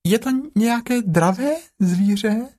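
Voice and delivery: very high, small piglet voice; high-pitched little animal. Acted. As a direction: squeak it out like a tiny piglet. Timid Piglet asks Pooh.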